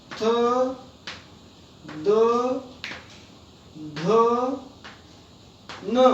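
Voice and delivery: each consonant sounded singly, with a long pause after it; letter-by-letter recitation. Shown as a demonstration. Teacher reading out the consonants to children, spaced letter-by-letter recitation. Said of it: "हम पढ़ते हैं".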